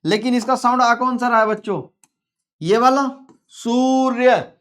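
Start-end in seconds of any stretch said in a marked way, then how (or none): 3.5-4.5 सूर्य stretched as a long "soor-ya"; slowly sounded out. Shown as a demonstration. Teacher showing pupils the last sound, सूर्य drawn out. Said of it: "last में क्या आ रहा है".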